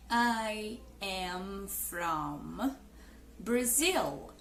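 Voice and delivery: very slow, low and soft learner's English; painfully slow speech. Shown as a demonstration. YouTuber imitates a hesitant beginner speaking to natives, painfully slow. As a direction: drag it out word by word, far too slowly. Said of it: "Se você ficar falando assim".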